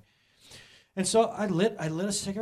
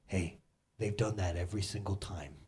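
lower, softer calm voice of Jesus; a hint of Jesus gently reassuring; Jesus speaking; 12 semitones lower, 7 dB quieter than usual. Preacher voices Jesus comforting the mocked believer, lower and soft. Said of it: "this is what Jesus would say to you".

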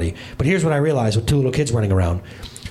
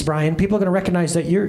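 ordinary delivery

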